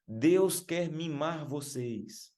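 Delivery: high, drawn-out mock crowd call; imitated shout. Preacher mimics singer calling to thousands of youths, imitated shout. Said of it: "começou a gritar".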